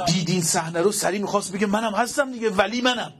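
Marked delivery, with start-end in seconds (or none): 1.7-3.1 higher, whiny boastful voice; mocking villain voice. Preacher mocking the villain's boast, whiny. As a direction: sneer it like a braggart.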